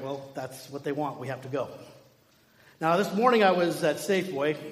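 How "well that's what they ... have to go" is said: soft, low-pitched acted resignation; resigned reply. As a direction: give in quietly to what must be done. Joseph answers Mary's objection, resigned.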